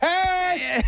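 high, cheery hey with a laugh; boat wave greeting. Retold or imitated. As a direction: greet a passing boat happily. Friendly boat greeting acted out.

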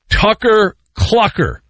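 TUCKER CLUCKER slow and carefully separated; measured, deliberate pacing on Tucker Clucker; said very slowly. Shown as a demonstration. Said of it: "Say that very slowly".

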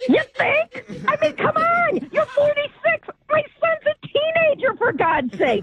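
high-pitched real indignation; real exasperated outburst. Real, not acted. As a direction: protest in disbelief, voice pushed up high. Mother confronting her son's older girlfriend.

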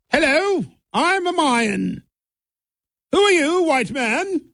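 high-pitched, hammed-up British royal; mock British royalty. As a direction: put on a high, plummy British royal accent. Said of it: "making the Incas and the Mayas sound like British royalty".

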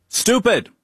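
normal talking voice

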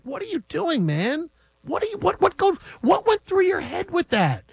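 high, slow, incredulous complaint; exasperated rant. Real, not acted. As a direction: vent at the coach, slowly and high. Ranting at the coach.

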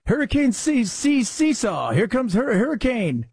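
high, stuttered "C-, C-, Seesaw"; mock stumbling. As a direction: fumble "Seesaw" and break off on "Hur-". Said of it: "watching people try to say".

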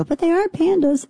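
squeaky little inner voice; a tiny nagging voice in your head. Retold or imitated. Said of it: "a tiny voice in the back of my head go".

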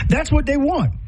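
high, laughing through the words; delighted laugh. Real, not acted. Speaker delighted at presence-as-safety idea, laughing. Delighted by her own insight.

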